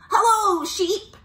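high, loud sing-out greeting; cheery high-pitched character hello; Duck. Acted. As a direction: call out hello in a high storybook voice. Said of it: "said Duck".

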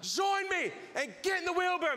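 high, insistent acted call; shouted dare. Walker daring the man to join him, shouting.

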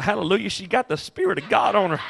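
quiet, quick, laughing aside; laughing joke. Retold or imitated. Preacher joking to the congregation, laughing softly. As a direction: laugh it off under your breath. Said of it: "I was like".

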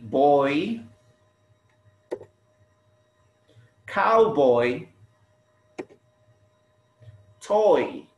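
put-on boy, cowboy, toy, each said alone with long pauses; repeat-after-me model. Shown as a demonstration. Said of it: "Please repeat after me".

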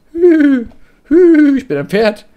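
goofing as a whinnying horse looking for Christoph; silly horse voice. Pretend. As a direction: play the horse, whinnying high and loud. Mimics a horse while hunting Christoph.

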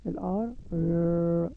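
slow stretched ر after ال ار; letter sound demo. Shown as a demonstration. Teacher sounding out letters for kids, slow ر. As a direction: hold the sound ر long and clear. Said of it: "نطقها ازاي".

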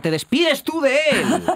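high, slow, dramatic reveal; dramatic exclamation. Acted. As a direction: announce it with a big theatrical flourish. Dramatic twist.

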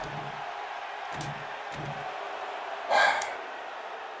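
long weary exasperated sigh. Real, not acted. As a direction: let it all out slowly.

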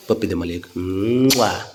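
soft, low ask for a kiss with a smack; tender kiss request. Real, not acted. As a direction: coo it to the little one. Asking child for a kiss. (बाळाकडे प्रेमाने पप्पी मागणे)

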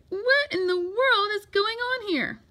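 high-pitched, astonished question; shocked cry. A narrator's reading. Parents to son about construction crew, shocked cry. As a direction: demand an answer in shock. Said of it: "they cried".

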